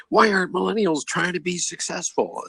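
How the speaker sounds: high-pitched upset questioner; imitated upset. Upset woman standing up at a workshop, high imitated complaint. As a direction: demand an answer, voice pushed way up. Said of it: "she was very upset".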